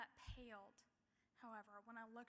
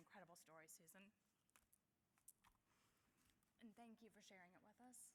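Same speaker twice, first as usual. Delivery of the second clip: choked up; 4 semitones lower, 15 dB quieter, faster than usual. Real, not acted. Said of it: "I'm just a little emotional".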